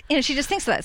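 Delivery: ordinary delivery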